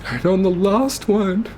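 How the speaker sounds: fighting tears through it; holding back tears. Real, not acted. Telling viewers how Mum died, voice breaking up. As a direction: voice breaking, slow and high. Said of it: "I need to get myself together 'cause you can't understand me".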